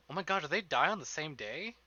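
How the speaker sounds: ordinary talk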